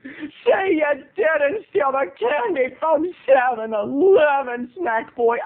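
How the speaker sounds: gasping, high, drawn-out disbelief; hammed-up horrified plea; mock shock. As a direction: play it scandalized and hammy, stretching the words. Voices a shocked listener, mock horror.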